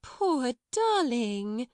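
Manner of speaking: high, very slow, drawn-out coo; cooing sympathy. A narrator's reading. Miss Amelia trying to calm screaming Lottie, cooing. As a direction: coo soothingly, stretching each word. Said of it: "trying first one method and then another".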